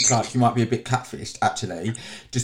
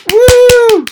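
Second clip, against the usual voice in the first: cheer; 16 dB louder than usual. Real, not acted. Celebrating the end of the thanks.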